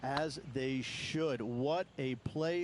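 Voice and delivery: drawn out, savouring each word; slow, relish the praise; slow emphatic approval. Real, not acted. Commentator savours the shot.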